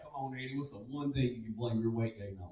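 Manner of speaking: very soft, low-key doctor's voice; coaxing doctor. Acted. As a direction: coax the patient quietly. Doctor gently pressing the patient.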